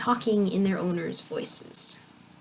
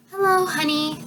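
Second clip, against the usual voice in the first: lightly voiced sweet lady, pitched higher; a hint of a lovely mother's voice, higher; Melisandre's beautiful voice; 7 semitones higher than usual. A narrator's reading. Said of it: "fair Melisandre's beautiful voice".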